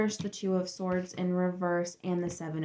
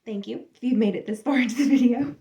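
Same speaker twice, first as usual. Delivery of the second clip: laughing thanks; 5 semitones higher, 8 dB louder, faster than usual. Real, not acted.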